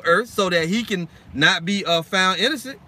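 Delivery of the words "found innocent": ordinary talk, nothing marked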